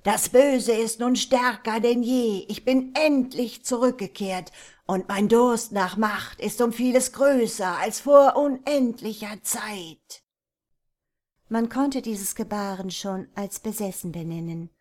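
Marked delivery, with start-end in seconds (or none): 0.0-10.2 undead king. Acted. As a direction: proclaim it like a risen dark lord. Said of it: "schrie Hisoma in die Landschaft".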